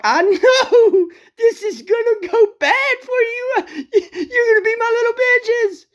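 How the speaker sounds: high, laughing taunt; gloating laugh. Acted. Prophet mocking Baal's prophets with high laughing glee. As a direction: crow over the rivals, cackling.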